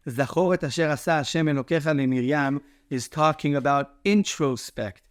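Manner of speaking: normal talking voice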